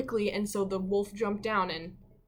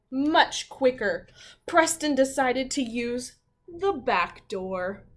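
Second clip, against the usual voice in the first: slow, louder storybook reading; slow story reading; 3 semitones higher, 6 dB louder, slower than usual. A narrator's reading. Teacher reading how Preston escapes, slow and louder. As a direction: savour the trick.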